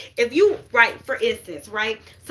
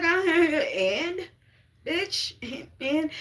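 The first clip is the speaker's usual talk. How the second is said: high, laughing through the words; can't stop giggling mid-answer; laughing reply; 6 semitones higher, much slower than usual. Retold or imitated. Teller laughing back at her friend, giggling. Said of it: "I'm like".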